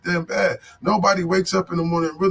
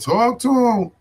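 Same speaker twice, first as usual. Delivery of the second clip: higher and louder, punched out; shouted catchphrase; 6 semitones higher, 4 dB louder, much slower than usual. Real, not acted. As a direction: call it out like a slogan.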